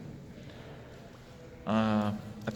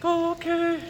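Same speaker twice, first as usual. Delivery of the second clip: high falsetto acceptance; roommate impression; 4 dB louder than usual. Roommate accepting the offered coat, mocking falsetto. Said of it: "he's like".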